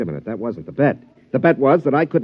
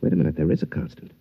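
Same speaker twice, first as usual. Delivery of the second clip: hushed realization; 6 semitones lower, 5 dB quieter, faster than usual. Acted. Golfer hits on the robot's weakness.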